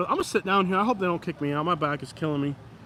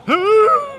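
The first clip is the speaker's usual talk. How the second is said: high, loud sustained howl; imitated scream; 10 dB louder than usual. Vlogger showing viewers the background screamer, imitated scream. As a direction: mimic a distant screamer with one long hoot. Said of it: "There's somebody, like, screaming".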